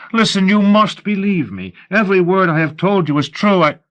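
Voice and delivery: high, urgent insistence; desperate plea. A narrator's reading. Captive convincing interrogator of his innocence, desperate. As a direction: beg to be believed, voice pitched high.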